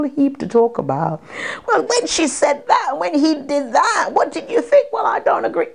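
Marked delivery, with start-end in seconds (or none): 1.7-5.7 high-pitched mock argument between theatregoers; animated debate. Actress imagining audience debating the play on the way home, animated. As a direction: act out a lively back-and-forth, pitched way up. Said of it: "There'll be all kinds of discussions".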